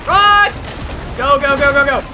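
loud high yelling to flee; frantic escape yell; panicked shout. Real, not acted. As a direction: scream at yourself to run. Panicked chase in horror game.

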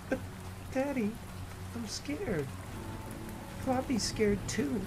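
put-on high, soft frightened child's voice; a small girl afraid of the storm; scared little girl. A narrator's reading. Daughter tells daddy she and Floppy are scared, high and soft.

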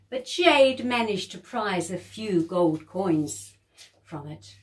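usual speaking voice

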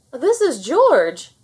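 loud, slow, thrilled shout; excited shout. A narrator's reading. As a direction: shout it out in delight. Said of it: "he shouted".

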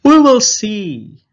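loud, slow carefree call; birds calling back. A narrator's reading. Flock of birds shouting back carelessly. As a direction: brush off the warning loudly. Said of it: "answered the birds".

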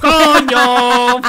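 loud, exasperated outburst; swearing yell. Real, not acted. Gamer swears at a mishap, loud yell. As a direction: yell the curse out.

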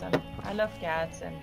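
normal talking voice

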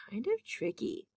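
whispered aside. Real, not acted. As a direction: lean in and whisper it. Teacher aside to viewers.

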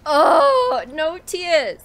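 high, loud tearful wail; letting the tears out; tearful cry. Real, not acted. Gamer moved to tears by a sad scene, crying out. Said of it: "making me cry".

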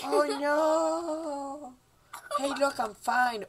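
put-on high, stretched-out kid voice; son voice. Son reassuring dad after accident, high kid voice.

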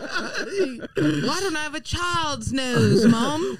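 high, very slow mock lament; hammed-up childish complaint; whining child.

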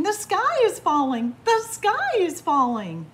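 high, loud storybook screech; panicked screech. A narrator's reading. Chicken Little alerts the farm after the acorn hit, screeching. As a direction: screech the alarm at full pitch. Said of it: "She popped up screeching".

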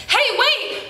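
high call after someone. Acted. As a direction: shout for him to wait. Calling out to the boy.

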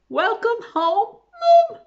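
high, loud, slow greeting; joyful greeting. Acted. Tom joyfully welcoming his mum home. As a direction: cry it out with joy.

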